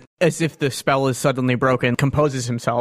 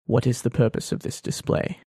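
soft, breathy, whispered question; hushed puzzled question; 2 semitones lower, 5 dB quieter than usual. Acted. Thrawn asks Chafra about the display in a hushed whisper. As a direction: half-whisper it, baffled but controlled.

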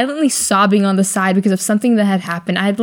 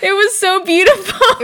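high, laughing mock wail of it was so beautiful; laughing mock sob; 11 semitones higher than usual. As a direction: squeak it out through laughter, mocking a crier. Crying friend quoted, mocking.

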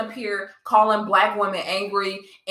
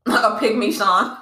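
laughing. Real, not acted. Repeating friend's joke, amused.

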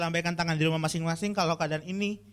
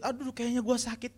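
higher whiny panic over a cough; hammed-up hypochondriac; 7 semitones higher than usual. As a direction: play the scared hypochondriac. Hypochondriac youth after sneeze.

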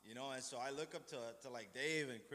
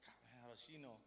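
much softer, amazed under the breath; quiet inner thought; 3 semitones lower, 12 dB quieter, much faster than usual. Retold or imitated. Thinking to himself that she read his mind, hushed amazement. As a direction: wonder aloud to yourself.

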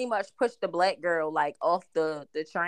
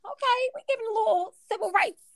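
sarcastic high voice; 12 semitones higher than usual. Retold or imitated. Sarcastic retold reaction.

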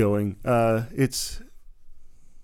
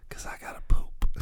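hushed unvoiced whisper; whispering over a crib; 3 dB quieter than usual. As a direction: whisper so the baby stays asleep. Pretend sneaking out of nursery.

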